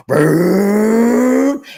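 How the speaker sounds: loud revving engine noise; high, loud motor brrr; engine roar. Retold or imitated. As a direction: mimic a car roaring away. Car engine roaring off.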